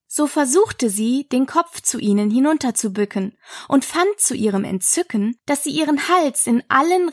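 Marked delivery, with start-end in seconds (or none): none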